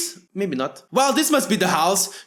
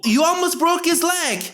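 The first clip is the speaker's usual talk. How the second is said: higher mom voice; 6 semitones higher than usual. Acted. Voicing mom.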